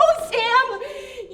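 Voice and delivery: loud, high cry through laughter; pitying laugh. Acted. Laughing, pitying her lover.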